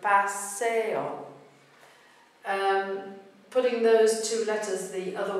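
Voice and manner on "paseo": "paseo" drawn out as pa-SE-o; careful three-syllable "paseo"; same three-beat rhythm. Shown as a demonstration. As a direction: stress SE in "paseo", final o separate. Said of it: "same thing happens if you put P".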